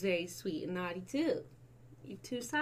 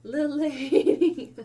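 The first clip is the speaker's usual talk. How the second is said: loud, slow, laughing words; 5 semitones higher, 10 dB louder, slower than usual. Real, not acted. Owner laughing while showing off her little dog.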